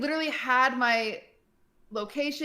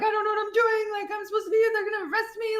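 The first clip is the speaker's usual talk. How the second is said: high, frantic tearful plea; panicked crying; 12 semitones higher, faster than usual. Retold or imitated. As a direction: relive the panic, voice cracking way up. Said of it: "and, like, crying".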